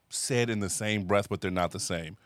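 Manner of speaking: ordinary delivery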